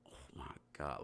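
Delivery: hushed, whispered mock Valley Girl. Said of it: "like, that Valley Girl".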